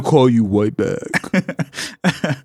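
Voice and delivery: slow put-on caller voice; mock flustered caller. Caller stalls the prank victim, slow and put-on. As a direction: drag it out like a stalling scammer. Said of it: "That's your best impression".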